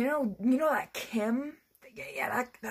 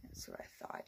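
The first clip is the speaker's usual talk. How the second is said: smug whisper; 15 dB quieter than usual. Real, not acted. Smug comeback after winning argument.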